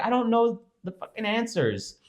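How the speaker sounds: high-pitched retold retort; exasperated reply. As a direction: answer mom, at wit's end. Retold exasperated reply to whining mom.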